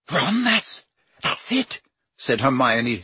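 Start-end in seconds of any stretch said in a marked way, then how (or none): 0.1-1.8 high, breathless, faltering realisation; amazed it was so obvious; stunned. Acted. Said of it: "sounding stunned".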